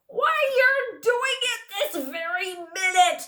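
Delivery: high, loud accusing bellow. Acted. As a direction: roar it out, catching him in the act. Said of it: "bellowed Trumpkin".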